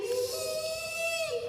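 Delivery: high excited squeal. Acted. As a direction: squeal like a giddy girl. Squeals with joy.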